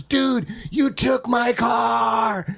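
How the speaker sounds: high, slow, stretched-out accusation; angry shout. Retold or imitated. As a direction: yell it slowly at the friend who took your car. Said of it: "Joe called again and goes".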